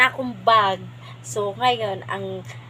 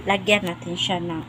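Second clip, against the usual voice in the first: normal talking voice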